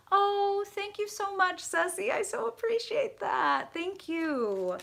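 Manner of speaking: very high, sing-song thanks; gushing gratitude. Real, not acted. Reader thanking a viewer, high gushing gratitude. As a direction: coo it way up high, touched and grateful.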